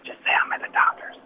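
quick hushed whisper to a stranger; whispered aside. Real, not acted. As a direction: whisper it so the boss can't hear.

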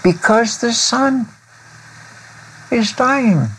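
high, thin, sorrowful little voice; Lily's high sad voice. Acted. Crying flower tells friends the sun is dying, high and sorrowful. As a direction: speak it up high like a small grieving flower. Lily weeps that sun dies.

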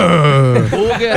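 slow, laughing mock Japanese; Japanese accent Portuguese. Shown as a demonstration. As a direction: stretch out Hambúrguer, Japanese accent. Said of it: "você tá falando em japonês".

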